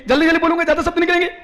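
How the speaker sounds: high, very fast delivery; rapid speech. Shown as a demonstration. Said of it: "आप जल्दी जल्दी क्यों बोलते हो".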